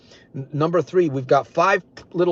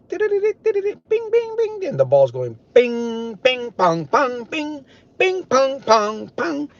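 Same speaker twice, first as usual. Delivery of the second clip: high bing-pong pinball noises; 10 semitones higher, 3 dB louder, much slower than usual. Retold or imitated. Mimicking pinball flippers for viewers, high bing-pong noises. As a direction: bounce between bing and pong like the ball.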